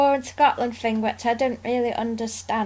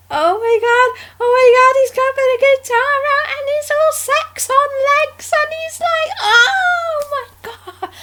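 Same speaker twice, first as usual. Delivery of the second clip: much higher, louder swooning gush; gushing fangirl; 7 dB louder than usual. Retold or imitated. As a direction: swoon like a starstruck fan. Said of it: "It's like".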